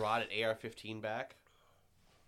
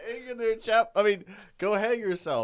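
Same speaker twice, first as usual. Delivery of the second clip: much higher and louder, hammed-up captain; mock high chirpy captain; 11 semitones higher, 10 dB louder than usual. Friend mocking captain's misheard cheer, much higher and louder. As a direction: squeal it like a silly captain.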